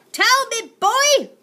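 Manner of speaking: very high, loud yell. Acted. Aunt Greta yelling at the boy, high and loud. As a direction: yell it at him. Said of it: "she yelled".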